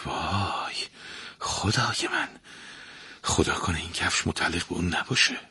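the prince mutters on seeing the daughter; under his breath. Acted. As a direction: mutter it so no one hears. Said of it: "زیر لب گفت".

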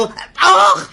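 high, sudden "ouch" yelp; comic pained yelp. Acted. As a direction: cry out as if tongue bitten. Has bitten his own tongue.

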